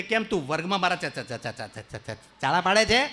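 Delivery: teacher stammers a scolding; mock stammer. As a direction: stammer ચા- over and over.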